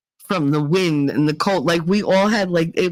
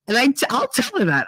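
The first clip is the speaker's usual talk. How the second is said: normal talking voice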